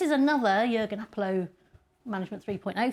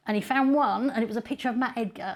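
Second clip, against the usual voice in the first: ordinary delivery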